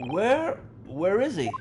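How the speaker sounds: urgently asking after a friend; worried hero voice. Acted. Player voices Ryunosuke asking after Kazuma, anxious.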